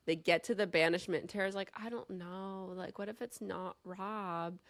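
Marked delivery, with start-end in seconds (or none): 1.8-4.6 imitating a timid waverer, very soft; small, dragging, unsure voice; hesitant doubt. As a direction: shrink back and say it quietly. Voices Tara losing her nerve.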